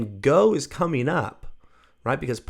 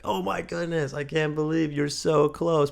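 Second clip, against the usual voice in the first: higher-pitched gushing excitement; super excited; 5 semitones higher than usual. Shown as a demonstration. Teacher cheering a student who nearly made it, super excited. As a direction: gush with demonstrated excitement. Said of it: "you can get super excited".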